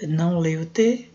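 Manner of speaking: ordinary talk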